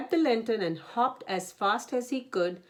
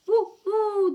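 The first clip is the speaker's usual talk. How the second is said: high, loud wind howl; 14 semitones higher, 7 dB louder than usual. A narrator's reading. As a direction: moan it like the wind in the trees. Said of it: "the wind blew on the trees".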